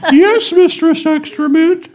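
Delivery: very high, slow squeaky yes; high slowed-down servant voice; slow falsetto reply. Pretend. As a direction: draw it out in a giddy falsetto. Mock submissive falsetto reply.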